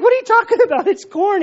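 high, loud disbelief; can't believe his ears; incredulous. Retold or imitated. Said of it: "And he's like".

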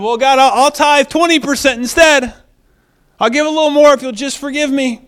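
high, loud wheedling offer; acted-out plea with a sweetener; mock bribing. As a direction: pitch a deal in an eager high voice. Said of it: "We don't bribe".

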